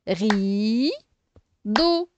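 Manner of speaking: "Rideau" split, long "Ri", then "deau"; teacher sounding out "Rideau" in two beats; syllable by syllable. Shown as a demonstration. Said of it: "on sépare la syllabe".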